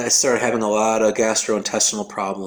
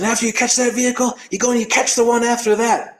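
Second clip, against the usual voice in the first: high, urging Australian coach; imitated Aussie pep talk, pitched up; Australian accent; 11 semitones higher than usual. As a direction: push them onward in an Australian voice. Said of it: "You're Australian?".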